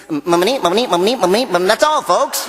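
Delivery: high stuttering Porky Pig voice. Retold or imitated. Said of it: "we talk about stutters".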